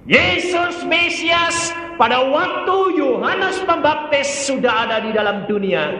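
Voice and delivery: high, loud proclamation; full-force preaching. Real, not acted. Preacher declares Messiah already in the world, full force.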